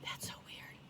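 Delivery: unvoiced spooked whisper; hushed, eerie whisper. Real, not acted. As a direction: breathe it out, creeped out. Spooked in the car.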